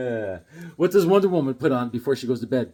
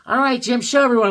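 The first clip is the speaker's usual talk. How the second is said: lightly voiced teacher; 6 semitones higher, 5 dB louder, faster than usual. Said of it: "she goes".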